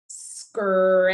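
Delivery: "Scrap" stretched long through every sound; long hissed s, drawn-out vowel in "Scrap"; slow sounding out. Shown as a demonstration. Teacher letting students hear all sounds, slowly stretched. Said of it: "need to say it slowly".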